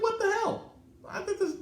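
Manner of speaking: high-pitched mock-outraged squawk; indignant retort. Retelling his protest to the tow company, indignant. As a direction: retell it incredulous, voice jumping way up.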